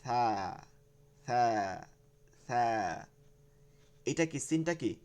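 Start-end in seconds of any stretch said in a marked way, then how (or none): 0.0-2.6 "সা" with a soft lisped th; soft, no hiss. Shown as a demonstration. Teacher drilling the soft letter, no hiss. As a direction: let "সা" out softly with no whistle. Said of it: "নরম আওয়াজ করতে হবে".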